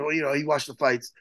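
ordinary delivery

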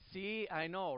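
ordinary talk

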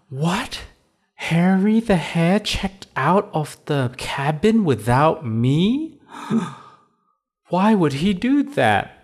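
high, dismayed bunny voice; shocked, then let the gasp out. Acted.

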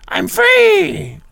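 high, loud witch shriek of freedom; villain cry. Retold or imitated. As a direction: screech it like a freed sorceress. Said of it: "Rita Repulsa".

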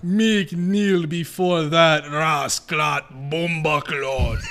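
slow, hammed-up fake Jamaican voice; mock Jamaican accent. Prisoner bragging about jail time in a slow fake Jamaican accent. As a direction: drag it out in a bad Jamaican accent. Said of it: "We're doing Jamaican next time".

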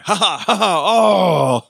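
slow mock villain cackle; slow evil cackle. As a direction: cackle it out slowly. Evil laugh.

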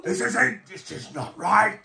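high, urgent draconic complaint; draconic prisoner. Acted. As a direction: protest as a dragon-faced captive. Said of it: "draconic looking face".

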